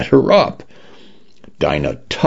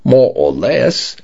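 Cockney condo voice; 7 semitones higher than usual. A narrator's reading.

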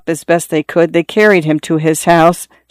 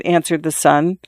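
usual speaking voice

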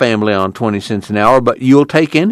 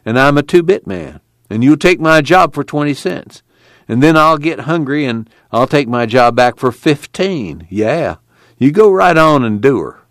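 bitter; 6 semitones higher than usual. A narrator's reading. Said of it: "he said bitterly".

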